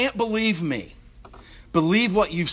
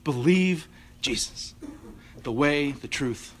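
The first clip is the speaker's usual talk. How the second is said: fading close; 3 semitones lower, 6 dB quieter than usual. Real, not acted. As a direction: let it die away softly. Closing fading benediction.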